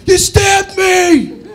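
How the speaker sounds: high, loud, slow stunned yell; stabbed father's shout. Retold or imitated. The father yells at his son over the fork, loud slow shout. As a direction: drag out the outrage at being stabbed. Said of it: "He goes".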